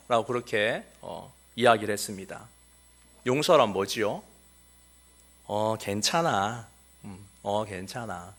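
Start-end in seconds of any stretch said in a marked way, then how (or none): 5.5-8.3 slow, soft, fading reassurance; a child answering an insult with a soft okay; gentle forgiving. Retold or imitated. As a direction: let it go calmly. Calm forgiving answer acted.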